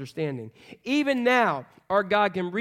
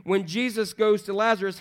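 usual speaking voice

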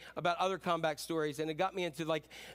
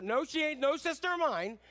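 higher, scornful refusal; dismissive sibling; 7 semitones higher than usual. Retold or imitated. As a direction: write her off with scorn. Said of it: "it's your sister".

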